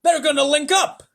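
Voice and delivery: high, loud shout. A narrator's reading. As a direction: yell it out in alarm. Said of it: "shouted the president".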